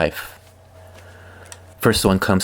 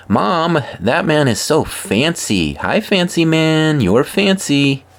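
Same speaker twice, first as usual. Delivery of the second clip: put-on excited child yelling; 8 semitones higher than usual. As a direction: call out like a thrilled four-year-old. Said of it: "four-year-old yelling".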